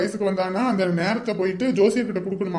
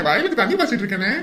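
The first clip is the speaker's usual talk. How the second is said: usual speaking voice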